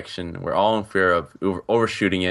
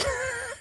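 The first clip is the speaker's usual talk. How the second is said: high breathy giggle; 9 dB quieter than usual. Real, not acted. Embarrassed laughter.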